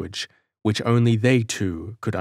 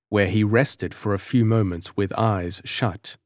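usual speaking voice

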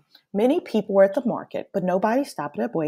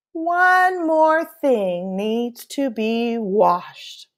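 very slow, knowing grandmother voice; teasing grandmother; 4 semitones higher, much slower than usual. Acted. Abuela hinting Pablo needs washing, slow and teasing. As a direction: draw it out, playfully hinting.

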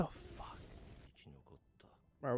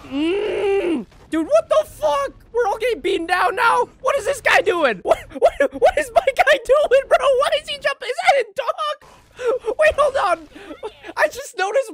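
high, loud, near-screaming disbelief; frantic yelling; 9 dB louder than usual. Real, not acted. Fan yelling at his guy on screen, frantic shouting. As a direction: yell at the screen in frantic shock.

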